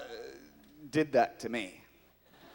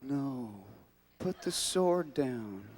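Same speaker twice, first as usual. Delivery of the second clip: ordinary talk, nothing marked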